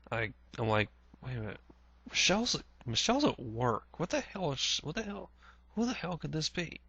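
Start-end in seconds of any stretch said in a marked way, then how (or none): 1.2-6.8 higher and softer, baffled muttering to himself; a hint of alarm, wondering who's at the door; puzzled disbelief. Confused at the door, who's ringing while sister's at work, higher and softer.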